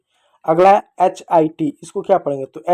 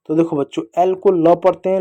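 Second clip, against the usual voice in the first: ordinary delivery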